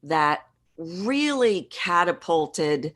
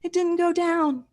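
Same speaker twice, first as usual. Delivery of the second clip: high-pitched voice; 13 semitones higher than usual. Shown as a demonstration. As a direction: still stuck up high. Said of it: "my voice went up like this".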